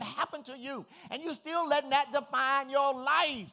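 high, loud preaching build; full-force preaching. Real, not acted. Preacher to congregation, loud and high preaching.

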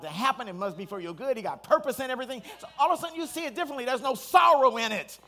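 rising preacher's climax; preaching at full force. Acted. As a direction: preach it up to a peak.

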